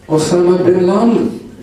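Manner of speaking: put-on slow, loud Greek-accented name with a nasal N before the D. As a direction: say "Osama bin Laden" like a Greek tavern owner, slow and loud. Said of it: "in the Greek accent".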